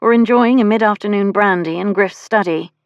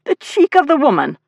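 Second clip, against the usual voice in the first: higher-pitched indignant outburst; indignant growl; 9 semitones higher, 4 dB louder than usual. A narrator's reading. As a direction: take offence on a brother's behalf. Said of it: "Reese growled".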